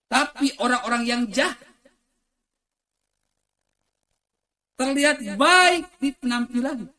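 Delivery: high, forceful preacher's shout; loud preaching. Real, not acted. Preacher warns of evil people who look good, shouting high. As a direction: ring it out over the crowd.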